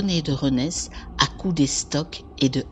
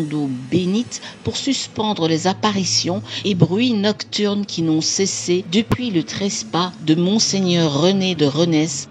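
put-on louder, raised old-document reading; solemn proclamation; 3 semitones higher, 4 dB louder than usual. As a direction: proclaim it like an ancient decree. Reads out old parchment.

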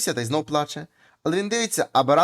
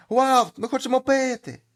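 high, loud thirsty squeal; squealing cry; 8 semitones higher, 5 dB louder, slower than usual. Acted. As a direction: squeal out a desperate plea for water. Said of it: "а там знов пищать". (високий гучний писк)

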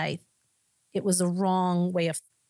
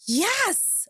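high, breathless retold "yes" of elation; gasp, then let the joy leap up high; acted-out burst of excitement; thrilled gasp; 13 semitones higher than usual. Retelling her answered-prayer reaction.

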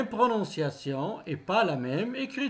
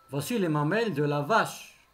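fast, connected example sentence; natural quick pace; 2 semitones lower, faster than usual. Shown as a demonstration. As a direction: run the words together briskly. Teacher's example at natural speed.

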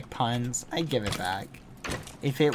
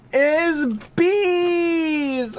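much higher, louder squeal of joy; excited squeal; 11 semitones higher, 5 dB louder than usual. Real, not acted. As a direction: burst out with delight. Excited about bees.

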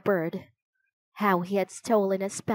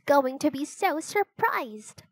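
put-on higher-pitched eager girl; gleeful and higher, like a girl with a surprise; excited; 6 semitones higher than usual. Excited child in the story.

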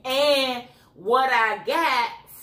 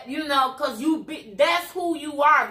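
usual speaking voice

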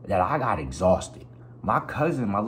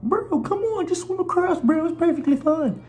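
high, loud mock surfer-bro voice, breezy; 4 dB louder than usual. Friends at the river coaxing him to swim, high mock bro voice.

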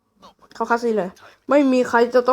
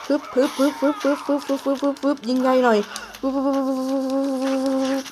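web-shot noises; 2 semitones higher, 4 dB quieter than usual. Pretend. Mimics web shots while playing.

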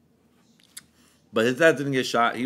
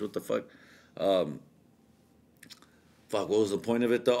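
normal talking voice

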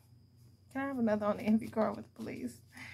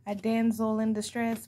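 usual speaking voice

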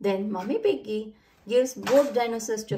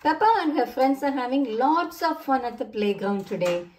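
usual speaking voice

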